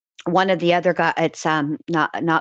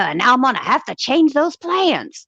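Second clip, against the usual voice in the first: Foghorn Leghorn whine; 7 semitones higher than usual. Retold or imitated. Lawyer whining to the judge about his trip, Foghorn Leghorn style. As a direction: plead it like a pompous cartoon rooster. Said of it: "Foghorn Leghorn glory".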